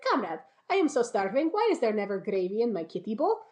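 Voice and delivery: hammed-up Russian accent, starving cat; thick Russian accent. Cat begging boyfriend for gravy, thick Russian accent. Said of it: "he has a thick Russian accent".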